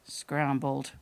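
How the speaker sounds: low, deadpan punchline voice; lightly voiced old man, pitched low; old man's answer. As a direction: drop low for one terse word.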